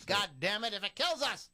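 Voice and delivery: rally everyone with mock grit; hammed-up battle cry; defiant rallying cry. Real, not acted. Psyching up to start podcast.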